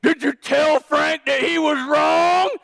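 high, loud, slow indignant question; mock the accusing churchgoer; imagined challenger. Imagined congregant challenging the pastor, indignant. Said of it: "somebody here saying".

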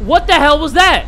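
high, loud alarmed cry; freaked out. Real, not acted. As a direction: demand it loudly, really spooked. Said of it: "freaked the hell out of me".

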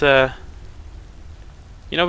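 normal talking voice

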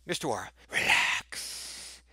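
hushed whisper to himself; calm yourself down under your breath; whispered self-calming. Real, not acted. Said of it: "Okay, sorry".

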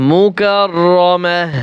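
মুকাররামাহ slowly, long rolled rr and final "mah"; pausal h ending. Shown as a demonstration. Teacher showing the word at waqf, slow h ending. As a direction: stop slowly on মুকাররামাহ, dropping -tin for h. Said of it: "ওয়াকফ করলে কি উচ্চারণ হবে?".

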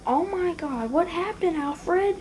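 high-pitched, shocked question; mock dismay. Finds the Batwing wrecked.

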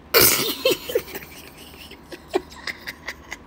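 breathless unvoiced laughter, can't hold it; wheezing silent laugh. Real, not acted. Vlogger cracking up at the letter, silent wheezing laugh.

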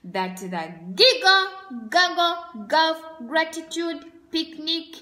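loud rising command; grand decree. Acted. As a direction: call the fun to begin. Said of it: "said Governor Gray".

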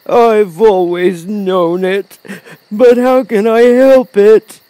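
loud, slow, high-pitched wail; ashamed lion. A narrator's reading. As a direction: lament helplessly as a cowardly lion. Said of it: "hanging his head in shame".

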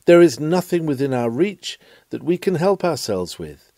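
ordinary delivery

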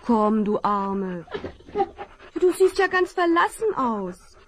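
woman soothing a lost collie, tender; tender to a dog. Acted. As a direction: comfort the stray gently. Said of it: "die freundliche Stimme".

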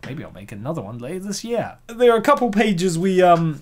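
normal talking voice

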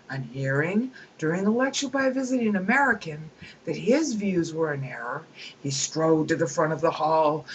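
lively storytelling read; reading aloud. Acted. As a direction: relish the satire as you read. Reads Dawkins anecdote aloud.

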